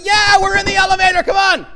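loud, high exasperated yell, quickly; frustrated shout. Retold or imitated. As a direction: yell back at the people outside. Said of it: "I'm going".

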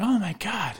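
high, soft mock swoon; hammed-up fan girl awe; imitated swooning girls. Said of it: "all the girls are like".

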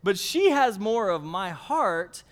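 usual speaking voice

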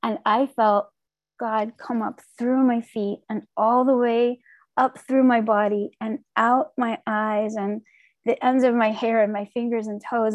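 ordinary talk, nothing marked

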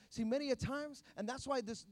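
ordinary talk, nothing marked

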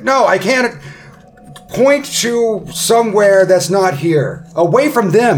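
loud, high-pitched panicky farewell; Ront, frantic to leave. Acted. Ront bids the party goodbye as he flees, loud and frantic. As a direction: blurt it loudly while making your escape. Game master plays fleeing Ront.

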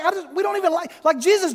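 very high, mock-exasperated stammer; hammed-up helpless appeal; flustered pleading. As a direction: fumble for words as an embarrassed disciple. Flustered disciples pleading with Jesus.

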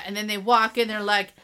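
ordinary talk, nothing marked

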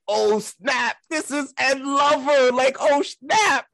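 starstruck, voice shooting up to a cry of "snap"; starstruck excitement. Retold or imitated. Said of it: "And I'm like".

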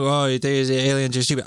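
light mocking quote of others. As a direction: toss it off as what others might say. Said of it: "that statement could come across wrong".

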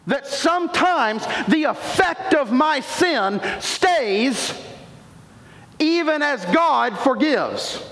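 slow, emphatic preaching with a shout. Real, not acted. Preacher drives home to the congregation that sin's effects stay, preaching with force. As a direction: lean in and preach it slowly. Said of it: "Now we're preaching".

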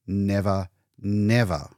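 ordinary delivery, nothing marked

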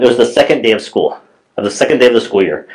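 ordinary talk, nothing marked

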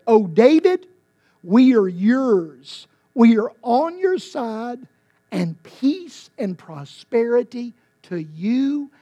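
loud, slow pledge of loyalty; a hint of loyal proclamation. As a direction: swear allegiance to the king. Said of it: "He said".

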